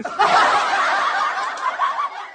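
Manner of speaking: a very high squeal of laughter; squealing laugh at her own joke. Real, not acted.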